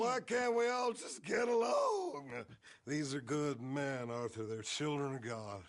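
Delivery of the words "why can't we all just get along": pleading shout. Acted. Drunk priest pleads at table.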